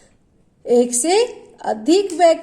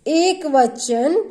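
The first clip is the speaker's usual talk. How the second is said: slow answer; much slower than usual. Shown as a demonstration. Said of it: "इसे हम क्या बोलते हैं?".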